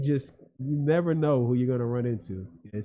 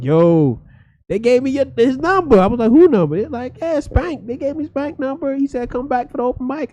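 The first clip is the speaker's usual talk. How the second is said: much higher friend's voice; my boy; 12 semitones higher, 7 dB louder than usual. Retold or imitated. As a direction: play the friend explaining about Spank. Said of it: "He's like".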